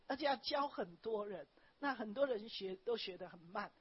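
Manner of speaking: normal talking voice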